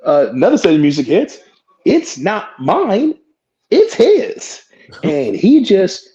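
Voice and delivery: ordinary talk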